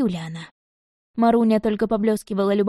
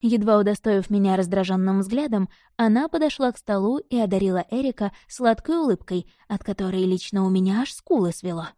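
ordinary delivery

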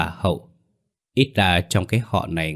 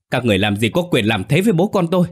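higher, louder, fast angry outburst; furious roar; 7 semitones higher, 5 dB louder, faster than usual. A narrator's reading. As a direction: hurl it back at the captors in rage. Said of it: "Bình tức giận gầm lên". (Bình gầm lên giận dữ, cao và to, nói nhanh)

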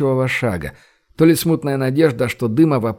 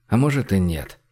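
ordinary delivery

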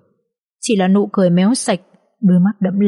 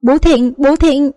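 put-on small child calling out; 7 semitones higher, slower than usual. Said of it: "liên tục túm lấy anh và gọi".

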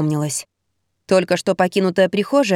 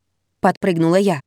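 usual speaking voice

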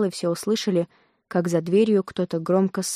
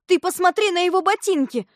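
put-on high, raised girls' voices; excited exclamation; 9 semitones higher, 4 dB louder than usual. A narrator's reading. Girls point out the impostor's boots, excited exclamation.

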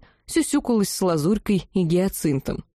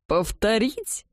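hoarse, airy wheeze; 3 semitones higher, much slower than usual. A narrator's reading. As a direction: rasp it out with no voice left. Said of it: "просипел граф Вестов".

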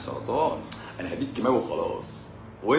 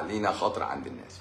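normal talking voice

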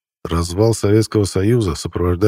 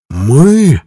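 higher, louder swelling indignant question; voice gaining strength; 6 semitones higher, 7 dB louder than usual. A narrator's reading. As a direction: let the word swell in outrage. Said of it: "голос его набирал силу". (голос набирает силу, громче и выше)

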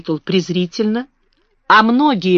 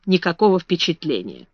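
plain reading voice

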